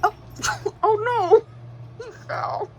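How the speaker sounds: sudden high yelp of shock; real startled oh no. Real, not acted. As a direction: caught off guard, cry out. Watching something fall live.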